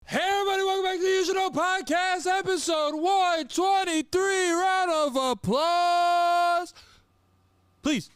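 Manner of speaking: loud, high podcast host intro; big over-the-top show opener; hype announcer. Acted.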